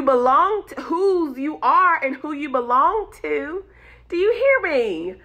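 high, loud exhortation; calling listeners to walk in authority; fired-up preaching. Acted. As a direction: preach it hard at the congregation. Preaching at full force.

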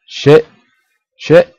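loud panicked cursing; loud swearing. Real, not acted. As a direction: shout the curses. Being chased, cursing.

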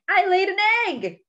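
high, loud, silly announcement; crying out. Acted. Duck joking atop a pumpkin, crying out. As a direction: proclaim it with playful pride. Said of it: "cried Duck".

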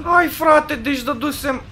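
high-pitched, loud complaint; exasperated protest. Real, not acted. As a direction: cry out in disbelief, way up high. Protests a hit that failed. (protest exasperat, pe ton foarte înalt)

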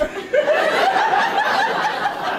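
a high squealing laugh; high squeal of laughter. Real, not acted. Comedian recalling dad in closet, high squealing laugh. Comic laughing at own story.

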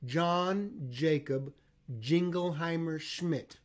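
John Jacob Jingleheimer Schmidt said very slowly; over enunciating. Shown as a demonstration. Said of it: "we say".

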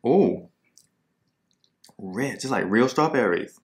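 chewing while talking; tasting a bite of ice cream sandwich; mouth full. Real, not acted. Seen 1: bites sandwich at -3, chews with lips moving through labelled words.

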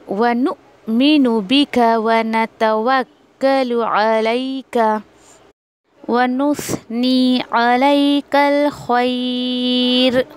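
slow, measured Quranic recitation. Shown as a demonstration. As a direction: draw each word out with tajweed. Recites dua slowly.

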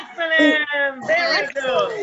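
loud, drawn-out teacher's cheer; excited praise. Real, not acted. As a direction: praise the right answer slowly and loudly. Teacher cheers a correct answer.

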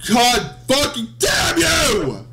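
high, drawn-out shout; real enraged yell; furious cursing. Real, not acted. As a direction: curse the game at full stretch. Cursing the game after failing.

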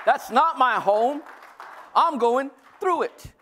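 high, loud preacher's proclamation; triumphant declaration. Acted. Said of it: "But you should be".

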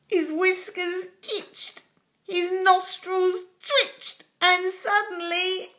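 high, loud, breathless storytelling; read-aloud drama of the big sneeze; building excitement. A narrator's reading. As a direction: race through the chaos.

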